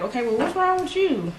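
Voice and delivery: 音调拔高、着急地追问; alarmed questioning. Retold or imitated. Retold confronting a sad friend.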